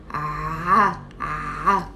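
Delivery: "A" low and pressed from the throat; ain sound. Shown as a demonstration. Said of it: "Say with me out loud".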